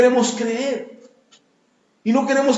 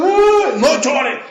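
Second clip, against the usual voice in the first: loud, slow, higher scolding yell; harsh yelled scolding; 7 semitones higher, 7 dB louder, much slower than usual. Retold or imitated. Parent yelling at a crying child while hitting him, loud. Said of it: "te pegaban y te decían".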